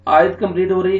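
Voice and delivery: ordinary delivery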